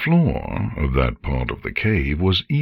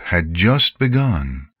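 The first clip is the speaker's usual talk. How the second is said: ordinary delivery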